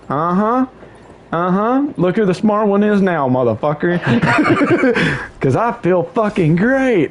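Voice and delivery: high-pitched mock yell, no real volume; retold gloating yell. Sibling gloating in hungover sister's ear, retold mock yell. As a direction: gloat teasingly, breaking into a laugh. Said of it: "And I yelled it in her ear".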